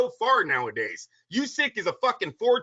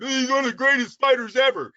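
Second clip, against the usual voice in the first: mocking quote; 5 semitones higher, 4 dB louder than usual. Mocking quote of European fans.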